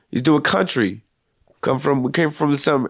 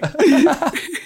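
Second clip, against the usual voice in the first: high laugh; 12 semitones higher than usual. Real, not acted. Laughing at own tease.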